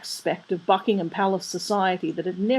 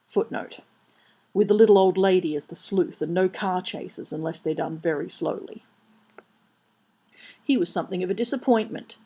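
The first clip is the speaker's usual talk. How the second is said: usual speaking voice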